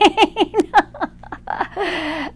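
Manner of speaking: long, high real laugh; rolling amused giggle; amused laughter. Real, not acted. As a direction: laugh it out helplessly. Amused at the unexpected answer.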